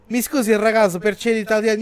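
imitating an Italian guy; Italian accent. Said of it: "o italiano fala italiano". (sotaque italiano)

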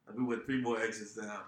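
ordinary delivery

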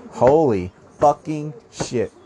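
slow, drawn-out shocked outburst; holy fucking shit, dragged out in disbelief; stunned exclamation. Real, not acted. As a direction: let the shock sink in slowly. Reacting in shock to own point.

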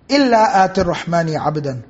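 fast, flowing chanted recitation of the verse; Quran recitation. Acted. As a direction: recite it with tajweed.

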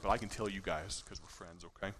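a hushed just-between-us aside; confiding aside. Real, not acted. Preacher letting the congregation in on a secret, hushed and confiding.

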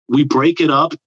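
usual speaking voice

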